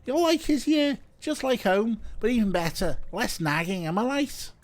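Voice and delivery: lightly put-on bad Scouse; bad Scouse accent. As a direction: chat in a hint of fake Scouse. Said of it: "I'm doing a bad Scouse".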